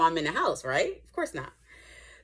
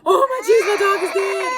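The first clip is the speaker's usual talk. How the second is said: much higher, louder crying wail; mock hysterical crying; 11 semitones higher, 5 dB louder than usual. Ten-year-old finding his dead dog, hammed-up crying wail. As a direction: ham up a kid's grief, half shouting. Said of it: "crying hysterically".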